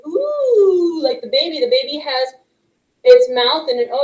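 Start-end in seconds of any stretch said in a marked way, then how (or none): 0.1-1.0 "Ooh" with rounded lips, long oo; ooh sound. Shown as a demonstration. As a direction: model the oo sound.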